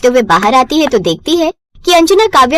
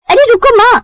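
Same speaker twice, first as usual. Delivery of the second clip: high call after mother; pleading daughter; 10 semitones higher, 3 dB louder than usual. Acted. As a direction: daughter begs mother not to hang up, high. Daughter calls out.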